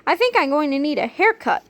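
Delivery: put-on high, musing kid voice; a boy eyeing himself in the mirror, musing aloud; thoughtful boy. A narrator's reading. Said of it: "said George as he peeked".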